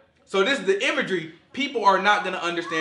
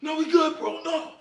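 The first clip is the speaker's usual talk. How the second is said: fake brave denial; 9 semitones higher than usual. Acting someone holding back tears.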